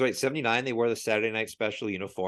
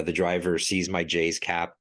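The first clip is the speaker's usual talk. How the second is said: normal talking voice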